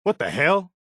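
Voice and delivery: sharp jump up in shock; surprised. Acted. Preston startled by something strange, surprised outburst. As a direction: blurt it out in disbelief. Sudden outburst in game barks.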